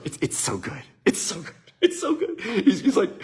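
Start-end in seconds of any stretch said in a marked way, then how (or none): 0.0-1.5 hushed, secretive teasing to build suspense; teasing whisper. Retold or imitated. Dad whispering to his son to tease a surprise. 1.8-2.4 sudden high squeal of delight; teasing squeal. Retold or imitated. Dad teasing his son, high and gleeful.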